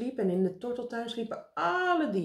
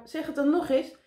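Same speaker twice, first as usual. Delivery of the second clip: high, insistent call; 8 semitones higher, 4 dB louder than usual. A narrator's reading. Meneer Pen tests the flying Karel, calling out. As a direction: demand it of someone overhead.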